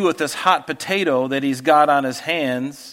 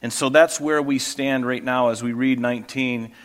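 normal talking voice

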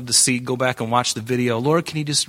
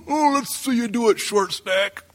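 high, jeering laugh then taunt; mocking giant; 7 semitones higher, slower than usual. Goliath taunting small David with a high jeering laugh. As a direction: sneer down at the little guy. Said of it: "this guy's going".

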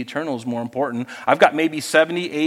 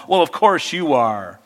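ordinary delivery

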